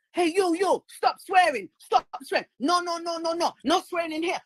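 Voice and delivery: high, mocking scolding of the guard; mock angry guard. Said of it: "They're getting all mad".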